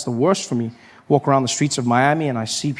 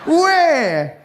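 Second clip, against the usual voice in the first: very high-pitched disbelief; mock astonished reaction; incredulous; 12 semitones higher, much slower than usual. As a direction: squeal the question as if you cannot believe it.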